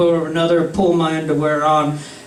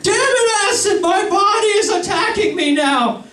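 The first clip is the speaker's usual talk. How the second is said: very high, frantic wail; hammed-up desperate high-pitched cry; panicked outburst; 14 semitones higher than usual. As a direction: wail at a tormentor in mock panic. Acted panic at Acid.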